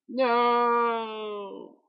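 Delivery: high, loud cry of dismay; desperate shout. Real, not acted. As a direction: yell it as everything goes wrong. Dying in the game.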